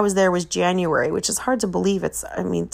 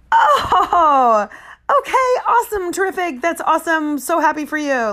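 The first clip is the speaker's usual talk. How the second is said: much higher, loud forced cheer with a laugh; fake delight; 10 semitones higher, 4 dB louder than usual. Mother answering son's vegan news, high forced cheer. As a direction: gush with relief that it isn't a baby.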